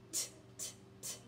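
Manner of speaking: "t, t, t" as crisp voiceless taps, no vowel; letter sound. Shown as a demonstration. Teacher models the T sound for kids, crisp and unvoiced. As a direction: tap out the "t" sound. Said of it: "Tortilla starts with T".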